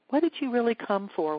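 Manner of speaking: ordinary talk, nothing marked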